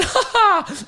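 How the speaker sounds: high mocking "ha ha"; sudden high-pitched mock laugh; laugh in his face. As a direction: re-enact a laugh right in someone's face. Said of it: "I laughed at the kid's face".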